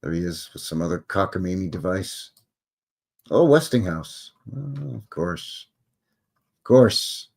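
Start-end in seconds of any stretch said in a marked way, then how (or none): none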